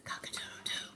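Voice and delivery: hushed, voiceless cock-a-doodle-doo; whispered rooster crow. Acted. Rooster who lost his voice tries to wake the farmer, whispering. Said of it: "he's still whispering".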